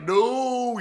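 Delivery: high, loud, drawn-out retold shout; pleading cry. Followers in court begging him to stop, pleading shout. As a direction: cry out like alarmed supporters.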